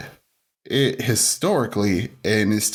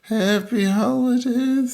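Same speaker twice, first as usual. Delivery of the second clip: very high, drawn-out holiday wish; exaggerated festive greeting; 12 semitones higher, much slower than usual. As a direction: stretch the greeting out slowly, up high.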